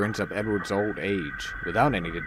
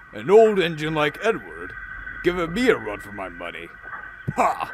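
offended huff; 5 semitones higher than usual. A narrator's reading. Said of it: "Gordon huffed, feeling slightly offended".

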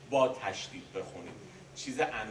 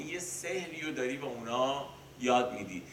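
ordinary talk, nothing marked

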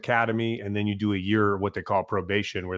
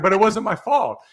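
whiny protest; 10 semitones higher, 4 dB louder than usual. Retold or imitated. Rookie whining protest.

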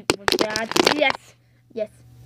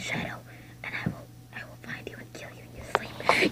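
fast, sinister whisper; menacing whisper; 16 dB quieter than usual. Acted. Shadow threatening the viewers, sinister whisper. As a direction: threaten them in a hushed hiss.

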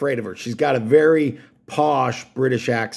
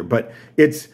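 normal talking voice

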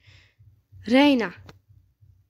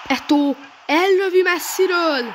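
excited commentary; 7 semitones higher, 4 dB louder, slower than usual. Real, not acted. Gamer commentating a shot.

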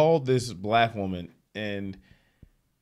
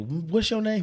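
higher, demanding woman's question; suspicious woman; 11 semitones higher than usual. Retold or imitated. Caller questioning fake mechanic, high suspicious ask. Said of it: "and she like".

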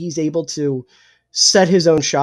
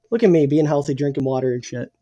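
usual speaking voice

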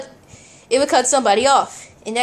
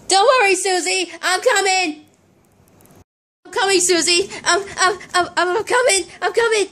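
higher, anxious reassurance on the run; urgent calling; 5 semitones higher than usual. Pretend. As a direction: call out that help is coming. Rushing to hurt Susie.

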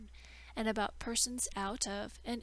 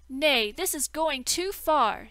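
loud, slow, outraged protest; indignant outburst; 5 semitones higher, 11 dB louder than usual. Acted. Learned man protests the deceit.

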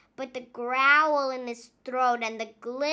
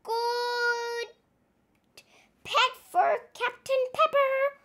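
Naughty Paul's voice; 9 semitones higher than usual. A narrator's reading. Naughty Paul suggesting a pet for the captain, higher and eager. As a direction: sound eager about the new pet, pitched up. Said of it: "said Naughty Paul".

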